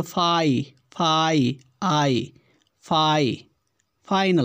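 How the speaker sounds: फाई, आई broken out, then whole फाइनल; "ai" sound isolated. Shown as a demonstration. Teacher demonstrates the different vowel, isolating "ai" as फाई, आई. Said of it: "उच्चारण थोड़ा सा different".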